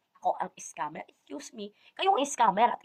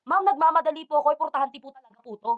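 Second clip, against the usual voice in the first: higher, louder pleading at ma'am; retold exasperated shout; 5 semitones higher, 4 dB louder than usual. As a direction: re-enact an irritated yell, easing off at the end. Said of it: "Sumigaw na ako sa pikon ko". (pasigaw na pakiusap sa pikon)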